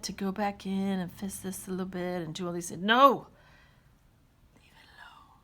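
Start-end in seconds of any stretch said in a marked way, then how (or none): 2.8-3.3 sudden loud, higher burst; mock-stern shout to resist temptation; emphatic refusal. Stern self-stop from temptation. 4.5-5.4 very soft, voiceless whisper; hushed restraint after an outburst; whispered self-warning. Pretend. Hushed warning to herself after shout.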